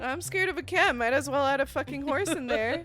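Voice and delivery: loud, high-pitched mock indignation; exasperated, loud and playful complaint; comic exasperated rant. Real, not acted. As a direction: rant incredulously, voice pushed up high. Comic rant about horse imagery.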